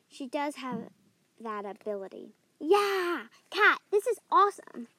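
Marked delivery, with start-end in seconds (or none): 2.6-4.9 thrilled shout; excited. Acted. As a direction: shout with delight. Thrilled with ghosts.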